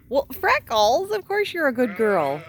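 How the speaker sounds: sweet talk the sheep up high. Real, not acted. Cooing at sheep, high and slow.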